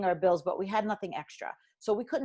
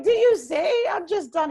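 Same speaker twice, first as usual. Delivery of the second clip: high, loud, tearful cry of despair; retold crying outburst; 11 semitones higher, 9 dB louder than usual. Telling the advisor at the kitchen table, higher and louder, tearful. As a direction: wail it out as if breaking down in tears. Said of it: "So I start crying".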